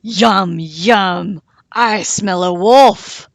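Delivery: loud growling chant; growling monster. A narrator's reading. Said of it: "still growling together".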